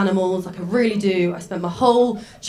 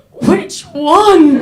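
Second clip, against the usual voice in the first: high, loud gasp then panicked question; alarmed child; 11 semitones higher, 7 dB louder than usual. Retold or imitated. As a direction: ask it as a horrified little girl.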